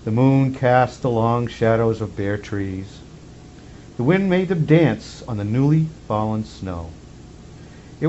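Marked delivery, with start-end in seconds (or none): none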